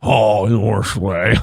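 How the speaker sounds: low hungry sneer; low alien voice. Pretend.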